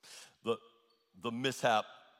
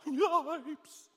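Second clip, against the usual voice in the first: startled yelp; 11 semitones higher than usual. Acted. Preacher voicing startled people.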